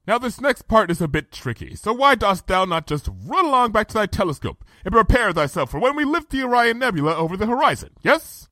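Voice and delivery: high, chirpy instructions, quickening; brisk regal princess. A narrator's reading. Princess sends her friend back to the telescope, brisk and high. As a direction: send them along with businesslike cheer.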